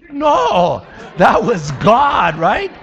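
loud, high declaration, half-laughing; emphatic shout. Real, not acted. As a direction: proclaim it loudly. Preacher shouts God's credit.

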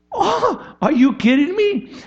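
high, loud cry of disbelief; shocked disbelief. Real, not acted. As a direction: react like you can't believe it.

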